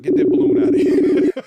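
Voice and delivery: playful loud protest, half laughing. Real, not acted. On his birthday, waving off a friend's balloon, playful loud protest. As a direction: shoo the balloon away, laughing.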